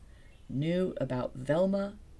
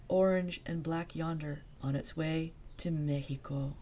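ordinary delivery, nothing marked

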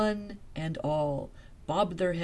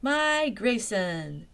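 high, louder, very slow echo; delighted echo; 5 semitones higher, 5 dB louder, much slower than usual. Acted. Velma echoing the guide, slow and delighted. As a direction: repeat it with wonder. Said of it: "repeated Velma".

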